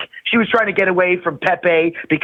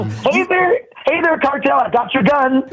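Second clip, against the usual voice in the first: high, bright hammed-up hail; mock greeting; 8 semitones higher than usual. Comedian greeting the cartel, high hammed-up hail. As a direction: hail the cartel like a goofy tourist.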